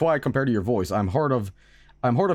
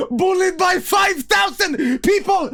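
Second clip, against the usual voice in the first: high, slow cry through real laughter; helpless laughter; 4 dB louder, slower than usual. Real, not acted. Streamer losing it at the chat's joke, laughing hard. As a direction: squeal it out while cracking up.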